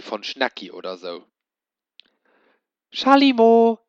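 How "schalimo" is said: high, loud singsong call across the house. A narrator's reading. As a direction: call the name from far away. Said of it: "rief Madame Feierstein".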